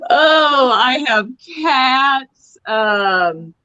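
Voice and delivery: breathless laughing through words. Real, not acted. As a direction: still giggling, slowed down. Laughing fit after punchline.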